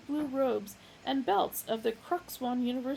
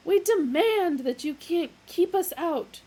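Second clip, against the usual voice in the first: loud, higher; bawling; 9 semitones higher, 9 dB louder than usual. A narrator's reading. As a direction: bawl it out. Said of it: "bawled the younger one".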